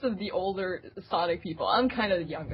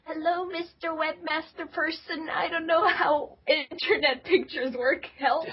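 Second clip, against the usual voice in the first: put-on high, slow, small helpless kid voice; a twelve-year-old writing the webmaster, high and timid; timid kid's plea; 9 semitones higher than usual. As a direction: sound like a shy little kid asking for help. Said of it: "And I was like".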